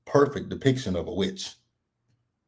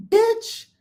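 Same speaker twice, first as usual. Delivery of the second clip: much higher squeal mocking Whitney; 3 dB louder than usual. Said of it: "when Whitney said".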